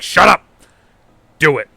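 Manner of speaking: loud sharp order; commanding shout. Retold or imitated. Dad orders son to strike, loud and forceful. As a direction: bark it at the boy to act.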